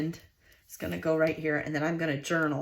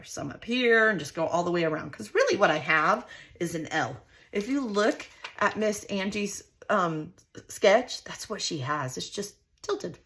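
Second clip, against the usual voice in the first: usual speaking voice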